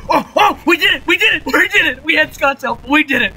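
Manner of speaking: high loud victory cheer; excited cheering. Real, not acted. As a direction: celebrate the win at full voice. Victory celebration.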